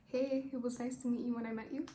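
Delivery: ordinary talk, nothing marked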